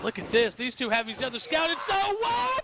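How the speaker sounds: excited play-by-play shouting; wrestling commentator. Real, not acted. Finish of a wrestling match.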